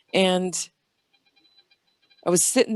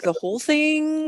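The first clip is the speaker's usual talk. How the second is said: high, drawn-out disbelieving question; retold incredulity; 9 semitones higher, slower than usual. Asking brother about purple eyes, retold amazement. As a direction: stretch it upward in disbelief.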